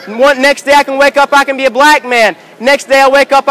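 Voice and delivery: normal talking voice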